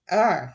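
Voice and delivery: loud frustrated ugh; angry roar. Acted. Count Olaf roaring at his defeat. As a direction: roar out in fury. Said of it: "Count Olaf roared".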